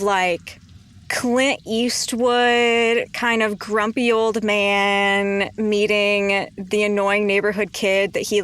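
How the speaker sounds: ordinary delivery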